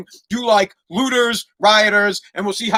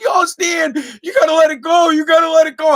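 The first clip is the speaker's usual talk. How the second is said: high, loud panicked shouting; freaking-out mom; 8 semitones higher, 5 dB louder than usual. Retold or imitated. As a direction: imitate a frantic mother yelling, pitched way up. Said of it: "my mom's freaking out".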